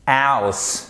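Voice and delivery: House with a clear gliding "ow" vowel; diphthong in house. Shown as a demonstration. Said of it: "c'est une diphtongue".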